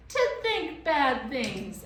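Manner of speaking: high, loud, slow creature echo; mocking echo. A narrator's reading. Gaboon parroting Lindy, mocking echo. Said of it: "echoed the gaboon".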